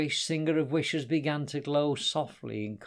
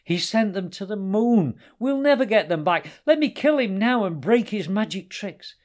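loud, high, agitated rant; loud, furious outcry; angry outburst; 9 semitones higher, 8 dB louder than usual. A narrator's reading. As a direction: rage at full voice, pitch raised. Enraged lion, acted quarrel.

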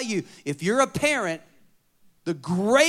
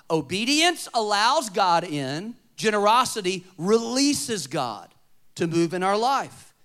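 slow emphatic; 3 semitones higher, 5 dB louder, slower than usual. Shown as a demonstration. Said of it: "slow emphatic teaching".

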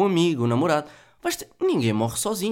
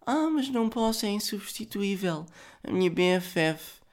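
higher, whiny imitation of her excuse; mock whiny excuse; 7 semitones higher than usual. Mocking Carolina's excuse about her best friend, in a higher, whiny voice. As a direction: whine it like a teen who won't let go.

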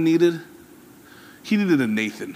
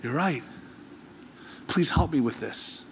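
humbled admission; 4 semitones lower, 13 dB quieter than usual. Retold or imitated. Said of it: "Solomon would have been like".

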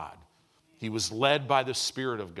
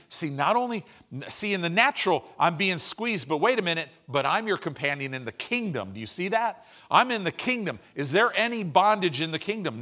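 higher, louder preaching build; fiery preaching; 5 semitones higher, 4 dB louder than usual. Acted. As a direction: lift it up and preach it out with fire. Preacher climbing to full force.

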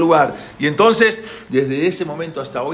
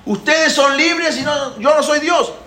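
high, loud, drawn-out cry; shouting; 11 semitones higher, 6 dB louder, slower than usual. Acted. Pharaoh's confession rings through Egypt, shouting. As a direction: shout so the whole land hears. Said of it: "se oyeron sus gritos".

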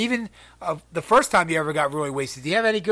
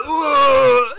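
ordinary talk

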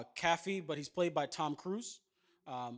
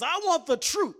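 high, loud insistent yell; shouted demand; 10 semitones higher, 9 dB louder than usual. Retold or imitated. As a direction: demand it at the top of your voice. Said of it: "He says".

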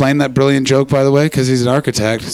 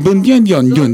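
gibberish Russian, slightly raised pitch; mock Russian accent; 3 semitones higher, 4 dB louder than usual. Shown as a demonstration. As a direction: demonstrate a Russian accent with nonsense syllables. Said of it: "it's like this".

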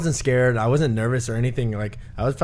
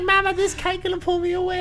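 put-on very high, childish voice; teasing a friend by imitating him as a child; mocking whiny kid. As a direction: mimic a scared little boy calling his mom. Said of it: "He's like".

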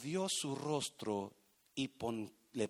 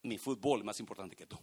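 dismissive husband; 7 semitones lower, faster than usual. Retold or imitated. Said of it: "Le está diciendo a su pareja".

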